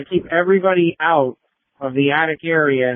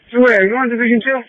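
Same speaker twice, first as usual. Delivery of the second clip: raised, higher urgent radio call; urgent shout; 8 semitones higher, 4 dB louder than usual. Real, not acted. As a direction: call it out fast, it's urgent. Urgent call during collapse warning.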